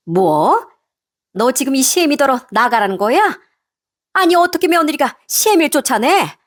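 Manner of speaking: affronted mother-in-law, pitch climbing; outraged mother-in-law. Acted. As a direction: act scandalised at being thrown out. Mother-in-law outraged at being thrown out.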